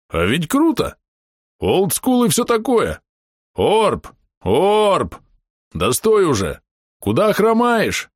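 high, gleeful calls; excited shouting. A narrator's reading. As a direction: shout it with glee. Excited half-orc calls out.

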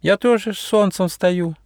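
high, huffy butterfly voice; miffed little butterfly; offended. Acted. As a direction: protest in a high, hurt voice. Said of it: "обиделась Уэлли".

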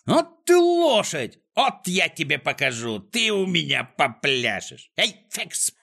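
high, drawn-out exasperated scolding; furious high-pitched villain rant; straining angry yell. A narrator's reading. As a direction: berate the useless dog at full strain. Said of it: "надрывался Клопс".

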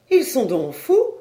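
high, loud incredulous question; indignant disbelief. A narrator's reading. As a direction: ask it as an outraged exclamation. Woman outraged at the relatives. (question indignée, haute et forte)

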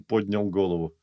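ordinary delivery

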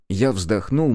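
plain reading voice

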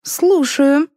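high-pitched, flirty reply; coquettish. A narrator's reading. Showman answering Liza's summons, coquettish. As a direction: answer coyly, voice lifted high. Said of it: "кокетливо произнёс шоумен".

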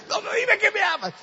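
put-on very high, loud girly plea; insistent girlfriend. Girlfriend insisting her boyfriend say he loves her, loud and high. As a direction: demand it like a pouting girlfriend.

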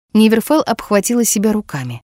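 ordinary delivery, nothing marked

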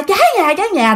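high alarmed yell; yelling fire. Retold or imitated. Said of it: "cái mợ đó la". (la cháy nhà hốt hoảng)

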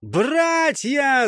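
high, rallying shout; yelling. A narrator's reading. Светил rallies his squad for the charge, yelling. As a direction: yell to rally your brothers. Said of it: "заорал Светил".

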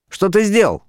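high-pitched startled question; alarmed wife. A narrator's reading. Wife sees torn card, alarmed high cry.

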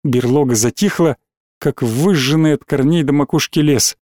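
usual speaking voice

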